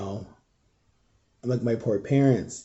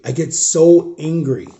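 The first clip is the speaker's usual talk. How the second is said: angry; 4 semitones higher, 5 dB louder, slower than usual. Real, not acted. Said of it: "and then I get angry".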